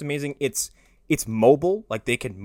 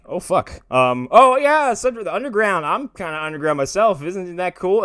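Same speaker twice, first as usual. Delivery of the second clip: retold nervous bluster; 7 semitones higher, 6 dB louder than usual. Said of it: "And Kano is like".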